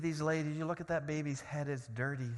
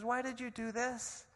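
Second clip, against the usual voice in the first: shocked sister; 10 semitones higher, slower than usual. Retold or imitated. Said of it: "My sister says".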